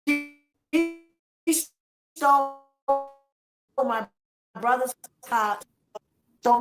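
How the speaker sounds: outraged. Real, not acted. Telling the hosts what Tina claims, outraged. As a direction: spit out the accusation in disbelief.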